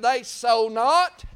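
preacher's crescendo; rising preaching. Acted. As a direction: build to a shout at barns. Preaching climax.

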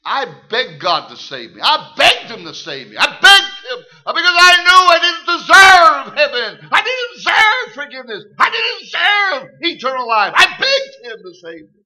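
building from firm to a high, loud cry; preaching at full force. Acted. Preacher tells the congregation how he begged for salvation, building to a shout. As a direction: let the plea swell into a shout.

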